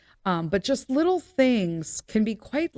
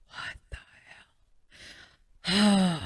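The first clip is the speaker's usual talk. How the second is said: whisper; 3 dB quieter, much slower than usual. Retold or imitated. Shocked at muddy shirt.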